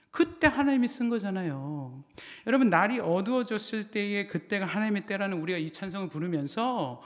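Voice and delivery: forceful exhortation, pitched well up; impassioned preaching. Real, not acted. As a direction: drive it home to the congregation.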